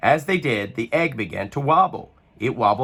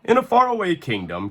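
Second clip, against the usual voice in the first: plain reading voice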